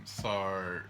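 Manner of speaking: normal talking voice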